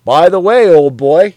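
loud, jovial bloke; a hearty colleague teasing; hearty Parsons. Acted. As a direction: boom it out matey. Parsons voiced in dialogue.

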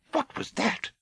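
sudden high-pitched alarm; startled. Acted. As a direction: break off, startled by a sound.

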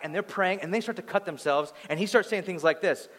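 ordinary talk, nothing marked

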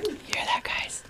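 whispered ASMR aside. Pretend. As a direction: whisper it close to the mic.

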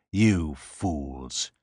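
low grating contempt; harsh grating voice. A narrator's reading. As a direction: grate the words out low. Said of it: "his harsh voice grated".

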